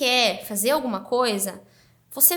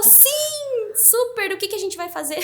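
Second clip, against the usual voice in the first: imitating a friend's thrilled, high-pitched yes; retold giddy enthusiasm; eager acceptance; 9 semitones higher than usual. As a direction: jump in high and eager, easing down into the question.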